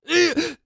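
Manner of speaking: high strained groan of pain. Acted. Man bitten on the hand by his lover, pained groan. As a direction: cry out as if bitten. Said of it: "一口咬住了刘玉奎的手".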